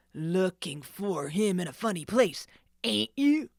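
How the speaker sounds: loud mocking sneer; mock her excuse. The thief doubts Nancy, sneering loudly. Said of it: "the man sneered".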